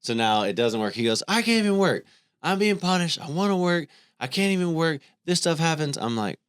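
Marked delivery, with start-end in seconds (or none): 1.3-6.0 high-pitched, louder mock whine of a frustrated kid; whiny frustrated son. Dad mimicking his son griping about the broken weed eater, high mock whine.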